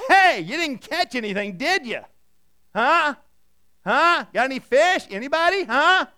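loud, high call across the water; yelling out. Acted. Jesus calling to the fishermen in the boat, loud shout. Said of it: "he yells out to the guys".

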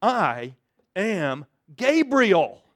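a big-shot angel putting a doubter in his place; indignant Gabriel. Acted. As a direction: puff up as the offended archangel. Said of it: "see this going".

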